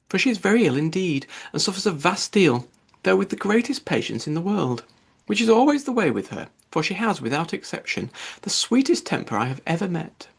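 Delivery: high, fluttering matron's voice; anxious mother praising her daughter to her host; fussy Mrs Bennet. Acted. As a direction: gush and fuss over the sick daughter.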